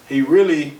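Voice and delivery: ordinary talk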